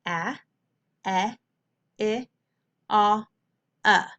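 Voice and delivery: "A, E, I, Ah, uh" as short vowels in apple, egg, itch, octopus, up; each short vowel alone, pauses between "A, E, I, Ah, uh"; short vowel sounds. Shown as a demonstration. Teacher showing pupils short vowel sounds, short and separated. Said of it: "What are my short vowel sounds".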